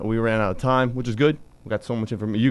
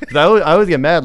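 excited; 6 semitones higher, 7 dB louder, faster than usual. Real, not acted. Laughing complaint about Twitter.

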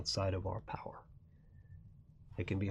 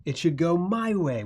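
loud, high-pitched impatient fuming; 6 semitones higher, 8 dB louder than usual. Acted. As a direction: act out a loud, frustrated protest. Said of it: "we're fuming and going".

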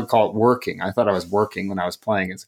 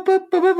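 mock news jingle; 3 dB louder, faster than usual. Mimics breaking news alert jingle.

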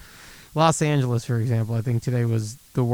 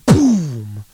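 firework boom; 6 dB louder than usual. Retold or imitated. Imitating firework explosion.

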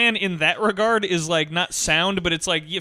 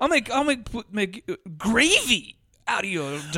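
sudden mock shout on gravy; imitating the Walken threat; Walken gravy line; 2 semitones higher than usual. Host imitating Walken's gravy threat, mock shout. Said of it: "when he says gravy".

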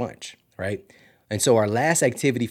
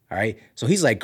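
usual speaking voice

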